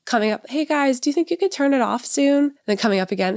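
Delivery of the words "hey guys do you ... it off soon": high, timid pleading; mock meek request, pitched up; passive parent. Mom mocks herself meekly asking kids to turn off TV, high. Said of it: "for me to be passive and be like".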